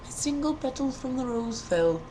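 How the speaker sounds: usual speaking voice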